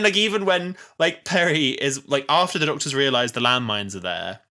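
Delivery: ordinary delivery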